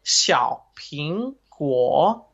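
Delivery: slow, careful tone drill on "Xiao Ping Guo"; drilled Chinese tones. Shown as a demonstration. Teacher drilling a learner on Chinese tones, slow and careful. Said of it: "over and over again".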